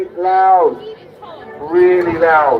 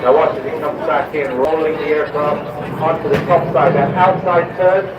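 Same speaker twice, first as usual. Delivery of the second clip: airshow commentator; 3 semitones higher than usual. Acted.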